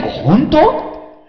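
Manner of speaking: high incredulous really?; mock surprise. Preacher feigns amazement at the shared dream, high surprised question. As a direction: gasp it as if amazed.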